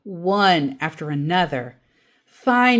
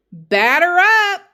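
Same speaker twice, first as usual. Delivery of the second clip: high, loud call, drawn out; 12 semitones higher, 7 dB louder than usual. A narrator's reading. Dad calling out to start the baseball game, loud and high. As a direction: call the batter up across the field.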